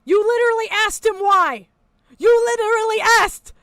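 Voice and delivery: outraged shout; exasperated yell. Real, not acted. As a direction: yell it at the guy in the video. Yelling at streamer on video.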